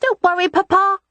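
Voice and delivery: put-on high, sure child's voice; a little boy reassuring his father; high confident child voice; confident small boy. A narrator's reading. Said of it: "Rye said confidently".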